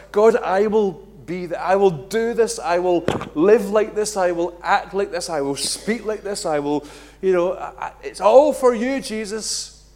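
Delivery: loud, high, fervent vows to God; over-the-top loud devotion; fervent promise. Acted. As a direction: pour out the promise loudly.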